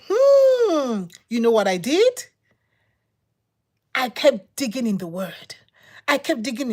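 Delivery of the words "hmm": high, drawn-out questioning hmm; a suspicious hum, pitched way up; musing hmm of growing doubt; doubtful hmm. Retold or imitated. Quoted reaction to pastor.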